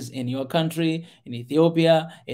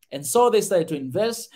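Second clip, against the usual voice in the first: ordinary talk, nothing marked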